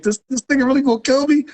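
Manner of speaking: very high-pitched, incredulous retelling; alarmed disbelief. Retold or imitated. Retold disbelief at gun threat.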